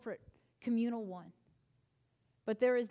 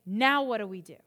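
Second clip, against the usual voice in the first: loud, high sermon delivery, driving every line; preaching at full force; 4 semitones higher, 7 dB louder than usual. Acted. Pastor rousing the congregation about anger, loud and high.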